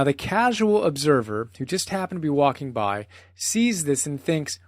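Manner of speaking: ordinary delivery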